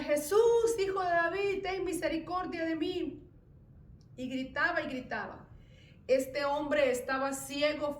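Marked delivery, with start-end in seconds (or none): 0.0-3.3 loud, high pleading shout; desperate cry. Retold or imitated. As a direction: cry out begging for mercy. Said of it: "este hombre empezó a gritar".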